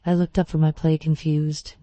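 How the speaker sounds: ordinary delivery, nothing marked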